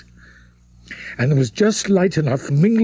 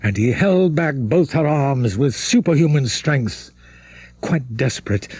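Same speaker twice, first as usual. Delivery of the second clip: plain reading voice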